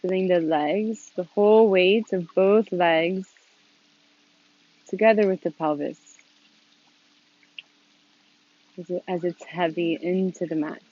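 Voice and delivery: calm, unhurried instruction with long pauses; gently leading a seated meditation, leaving space between phrases; guided meditation. Real, not acted. Leads a meditation.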